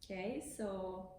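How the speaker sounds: ordinary talk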